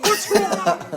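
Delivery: very high, panicked question; alarmed mothers. Retold or imitated. Listening mothers hearing a baby cry, very high panic. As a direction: shriek it like a worried mom.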